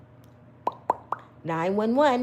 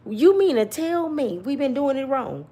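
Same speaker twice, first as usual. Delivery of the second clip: ordinary talk, nothing marked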